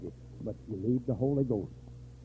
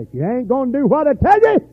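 high, loud, scolding bark; gruff doctor scolding; 12 dB louder than usual. Retold or imitated. Hard-headed doctor to a disobedient patient, loud scolding. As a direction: tell off a patient who won't listen. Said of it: "hard-headed doctors ... say".

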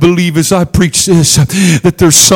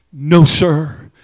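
normal talking voice

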